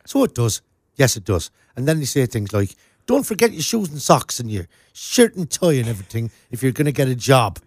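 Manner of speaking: thick southern Irish brogue; lilting, sing-song southern Irish; southern Irish accent. Shown as a demonstration. Co-host showing how they talk further south, stronger Irish accent. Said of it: "it gets even more like that".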